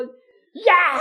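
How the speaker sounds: high, sharp call of reprimand; retold yell. Father turning on child after the sermon, yelling. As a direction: re-enact an angry father's yell. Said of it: "소리를 질렀어요".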